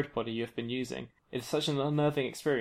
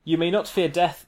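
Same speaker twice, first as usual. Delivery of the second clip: booming; 4 semitones higher, 7 dB louder than usual. Acted. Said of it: "the Shadow King booms".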